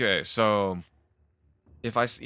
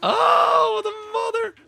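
very high cry of alarm; panicked scream; 7 dB louder, much slower than usual. Real, not acted. Player startled by a runner zombie, panicked high scream. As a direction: scream as it lunges at you.

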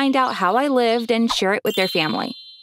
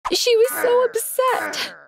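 tearful; 13 semitones higher, slower than usual. Acted. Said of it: "She couldn't stop crying".

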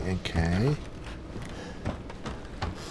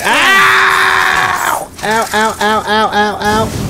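pained ow; 11 semitones higher, 3 dB louder, much slower than usual. Real, not acted. Hit by enemy.

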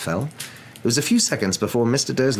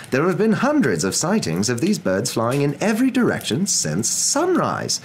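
higher, lilting news-report delivery; TV newscaster; 7 semitones higher than usual. Acted. Newscaster reporting owls to viewers, broadcast voice. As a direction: read it out like an evening news bulletin.

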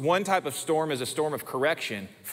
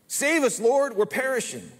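desperate plea; 8 semitones higher than usual. Acted. Disciples' plea in God's view.

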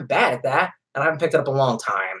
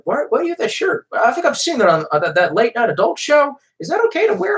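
put-on higher, curious kid voice; imitating a kid; 8 semitones higher than usual. Retelling the church kid asking about his shirt, curious. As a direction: ask innocently like a puzzled church kid. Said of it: "this kid was like".

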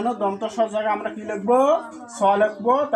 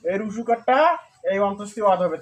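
usual speaking voice

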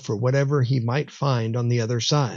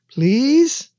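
put-on very high, louder child's plea; pleading; 13 semitones higher, 5 dB louder than usual. A narrator's reading. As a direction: beg with the pitch soaring into a question. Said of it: "he pleaded".

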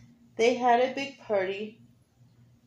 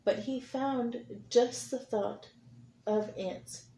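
gentle read-aloud storytelling; reading to children; 2 semitones lower than usual. A narrator's reading. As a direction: read it like a picture book for kids. Teacher reads picture book aloud.

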